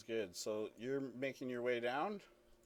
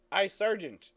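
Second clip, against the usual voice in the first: raised, higher call to a sergeant; yelled up; 6 semitones higher, 8 dB louder than usual. Acted. Guard to sergeant up the cliff, yelling up through the wind. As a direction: call up to someone far off. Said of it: "you can hear Gix yell up".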